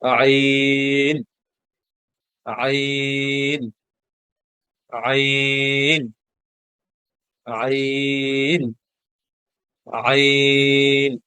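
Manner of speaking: slow stretched আইন from the throat; drawn-out Arabic ain. Shown as a demonstration. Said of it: "আমরা কি পড়ব?". (গলা থেকে টেনে আইন উচ্চারণ)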